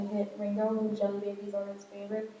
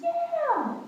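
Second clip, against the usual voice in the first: very high, drawn-out falling yeah; 5 dB louder than usual. Retold or imitated. Own reply retold.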